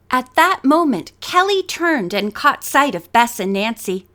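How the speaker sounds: usual speaking voice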